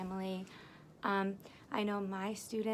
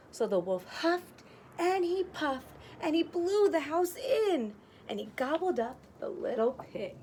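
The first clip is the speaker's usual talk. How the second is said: higher, louder dramatic read; dramatic storytelling; 8 semitones higher, 4 dB louder than usual. A narrator's reading. Teacher narrating the wolf blowing the house in, excited. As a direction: build the drama of the blow.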